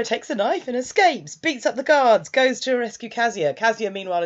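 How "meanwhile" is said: usual speaking voice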